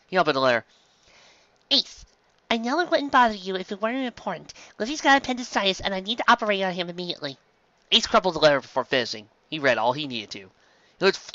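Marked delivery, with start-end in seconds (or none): 1.7-7.4 small high voice of a worried reindeer doctor, fast at the end; high, quick and anxious young doctor; Chopper's voice. Acted. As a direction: read the letter as the little doctor, urgent.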